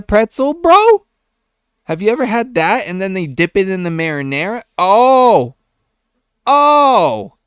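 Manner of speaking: high, loud, raving about food; excited. Real, not acted. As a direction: gush with big rising excitement. Raving about pretzel pizza.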